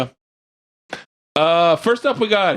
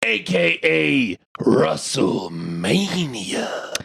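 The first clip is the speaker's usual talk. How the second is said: slow drawn-out wrestling announcer; ring announcer; 2 semitones lower, much slower than usual. Acted. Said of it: "my favorite one he does".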